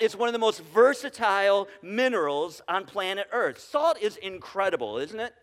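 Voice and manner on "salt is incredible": usual speaking voice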